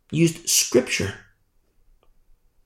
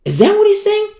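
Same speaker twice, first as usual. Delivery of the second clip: high-pitched, stammering disbelief; mock the clueless with squeaky, halting questions; baffled unbelievers; 9 semitones higher than usual. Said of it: "those who are blind and deaf says".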